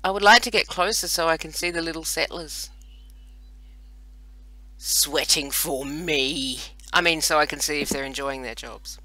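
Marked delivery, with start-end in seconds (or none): 4.8-6.6 long, drawn-out gloat over each word; savouring every syllable, very slow; mock gloating. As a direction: relish it slowly, like a petty overlord. Gloating slip about settlers toiling.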